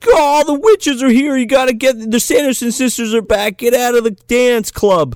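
hammed-up hysterical teen warning the party; mock panicked warning. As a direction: warn the crowd in a panic. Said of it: "he's like".

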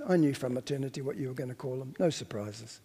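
low, quick, toneless mumble; bored. Acted. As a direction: imitate a bored God, low and soft. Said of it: "was he bored and yawned".

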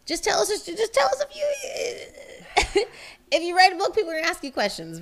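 high laughing mock exasperation, rushing the end; exasperated mock shout. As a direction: shriek it up high and break into a laugh. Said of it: "Oh, I was personally offended".

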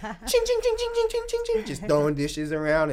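high chanted ching noises; high excited sound effect; dish clatter imitation.

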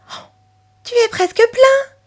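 put-on very high-pitched child's delight; excited little girl. Chloé slipping money into the pig, excited. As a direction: exclaim it high with happy anticipation.

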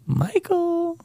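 high, slow creepy singsong; spooky taunt. Pretend. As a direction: tease like a creeping monster. Spooky taunt with possum picture.

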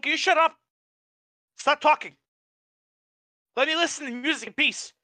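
high, pleading telling-off; exasperated retelling. Retold or imitated. Worker telling interrupters to stop talking, exasperated.

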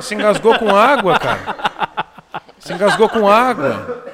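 chuckling through the words; laughing. Real, not acted. Laughs at friend choking.

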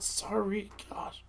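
embarrassed whisper; whispered apology; whispered sorry. Real, not acted. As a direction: breathe it out under your voice.

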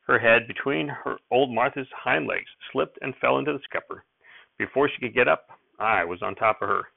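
plain reading voice

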